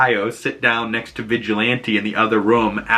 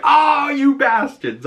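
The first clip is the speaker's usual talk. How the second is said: mock outrage; 4 dB louder, slower than usual. Reacting to cliffhanger ending.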